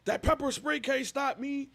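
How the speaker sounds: high, growling mock tough guy; raging tough guy. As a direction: roar like nothing can stop you. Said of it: "but he was like".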